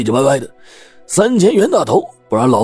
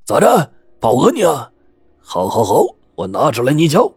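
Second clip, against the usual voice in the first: red-faced anger; 5 semitones higher than usual. Acted. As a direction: snap it out in offended temper. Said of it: "老炮儿气红了脸".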